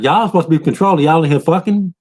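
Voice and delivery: quick high teasing voice; a soldier's fast high jeer; mock taunt. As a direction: rattle it off high, needling them. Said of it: "He like".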